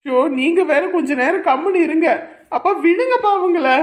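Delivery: high, loud, exasperated shouting to stop; frantic plea. Acted. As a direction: shout over both of them to break it up. Stopping father's attack. (பதற்றத்தில் உரக்கக் கத்துதல்)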